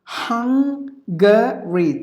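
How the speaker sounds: "Hungary" as HUNG, guh, ree; syllable by syllable. Shown as a demonstration. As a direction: separate each syllable of "Hungary". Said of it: "three syllables".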